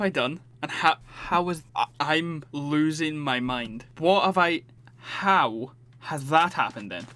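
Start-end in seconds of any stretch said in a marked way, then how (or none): none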